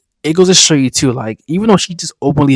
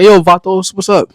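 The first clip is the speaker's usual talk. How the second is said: mock greeting; 7 semitones higher, 6 dB louder than usual. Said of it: "We don't go to random Mexicans and say".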